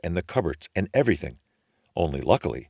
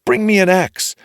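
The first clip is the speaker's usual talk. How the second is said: loud, much higher desperate shout; calling out; 13 semitones higher, 8 dB louder than usual. A narrator's reading. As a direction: yell for help from the beanstalk. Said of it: "he called out".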